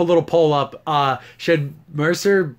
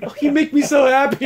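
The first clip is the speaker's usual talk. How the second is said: high, gushing mock-girlfriend voice; girly lovestruck girlfriend; 7 semitones higher than usual. Host retelling alien lovers' scene, mock girly gushing. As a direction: imitate a smitten girlfriend, pitched up. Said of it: "girliest girlfriend there ever could be".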